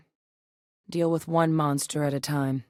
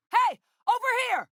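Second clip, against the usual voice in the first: very high, urgent holler; calling out. Acted. Calling someone to come over here, shouted. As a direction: call across a distance to get attention.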